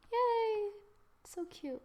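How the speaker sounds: ordinary talk, nothing marked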